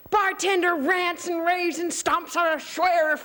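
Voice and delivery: high, drawn-out mock rage; mock ranting. Teller describes the furious bartender, mock ranting. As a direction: narrate it as if throwing the tantrum.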